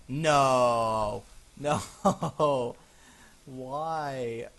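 loud, drawn-out no breaking into laughter; long loud nervous cry; dismayed wail. Real, not acted. Sees it goes further down.

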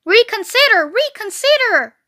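high, loud pleading cry; cried out. A narrator's reading. Goose begging Wilbur to turn back, cried out. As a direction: cry it out as a last plea. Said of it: "cried the goose".

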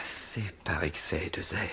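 whispering stage seducer; Tartuffe. Acted. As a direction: breathe the excuse intimately. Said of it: "je rêve de jouer Tartuffe".

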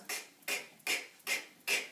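k as a short unvoiced click; phonics sound k. Shown as a demonstration. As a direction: click out k, k, k with no vowel. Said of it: "a kicking k".

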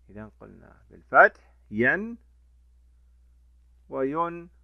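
ordinary talk

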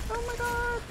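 high and soft, like a scandalised lady; high, soft exaggerated gasp. Gamer swamped by enemies, high mock gasp.

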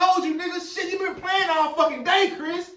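high, loud furious yelling; angry yelling. Acted. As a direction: scream at him for the money. Friend yelling about lost money.

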